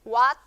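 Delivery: "what" as a single syllable, "wat"; o and a fused into one short "what". Shown as a demonstration. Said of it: "se hace una sola sílaba diciendo what".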